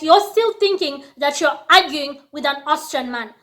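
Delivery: usual speaking voice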